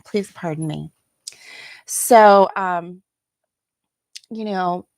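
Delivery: ordinary delivery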